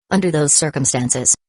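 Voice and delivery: usual speaking voice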